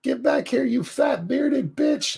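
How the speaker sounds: high, slowed, strangled movie-villain voice; shrieking Spaceballs villain line; Spaceballs villain impression. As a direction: squeal it out high and drawn-out like the film.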